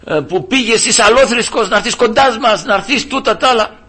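imitating a scornful boss ordering repentance; boss's preachy taunt. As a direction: lord it over him with jeering insistence. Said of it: "μου λέει".

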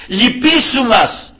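slow, high pleading demon voice; demons begging. Retold or imitated. Preacher voices demons begging Christ for mercy. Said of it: "να παρακαλάει και να λέει".

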